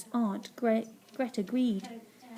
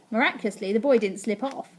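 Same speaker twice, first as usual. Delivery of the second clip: plain reading voice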